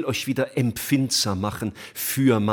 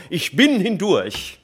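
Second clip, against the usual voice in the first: much higher, louder and slow, a victory cry; triumphant last words as imagined; 9 semitones higher, 6 dB louder, slower than usual. Retold or imitated. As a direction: proclaim it in triumph. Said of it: "sowas Triumphales".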